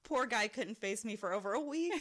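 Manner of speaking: usual speaking voice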